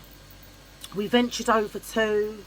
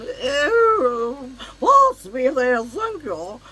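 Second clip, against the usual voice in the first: higher, louder snooty "Ew", slowly drawn out; mock posh picnicker; 5 semitones higher, 6 dB louder, much slower than usual. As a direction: sneer like a stuck-up racegoer. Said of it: "all posh".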